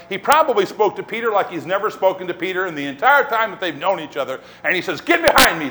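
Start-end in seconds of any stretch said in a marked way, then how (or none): 5.0-5.7 raised, stern rebuke; confrontational. Retold or imitated. Jesus confronts Peter as never before, raised and stern. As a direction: confront him with a firm command. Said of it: "He says it in a confrontational voice".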